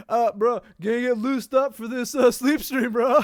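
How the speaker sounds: loud, high dudebro voice; frat bro. Retold or imitated. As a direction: do a dumb party bro. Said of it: "that sounds like the most frat boy thing ever".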